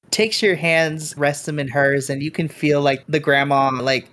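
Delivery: normal talking voice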